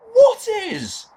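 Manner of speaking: very high-pitched baffled question; exasperated. Real, not acted. Comic questioning Jones's senseless line, high exasperation. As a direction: squeak it out in disbelief.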